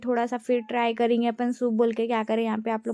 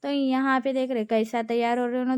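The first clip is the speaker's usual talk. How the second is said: usual speaking voice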